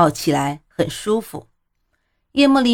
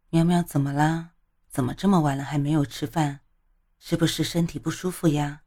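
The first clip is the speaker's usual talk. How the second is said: soft, concerned and gentle; caring question; 7 dB quieter, faster than usual. A narrator's reading. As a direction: ask tenderly, full of worry. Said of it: "关切地问".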